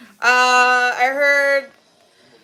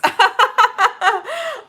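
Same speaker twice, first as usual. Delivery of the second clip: laughing; 12 semitones higher than usual. Real, not acted. Laughs while reassuring the chat.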